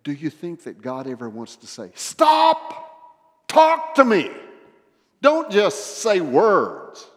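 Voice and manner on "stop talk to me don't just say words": very high, loud interrupting cry; exasperated shout. Acted. God interrupting our routine prayer, exasperated shout. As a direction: cut in with a commanding shout, then slow down.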